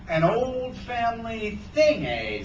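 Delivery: slow, high, drawn-out echo; suspicious echo. A narrator's reading. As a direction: repeat it slowly with suspicion. Hook echoes Sarah suspiciously.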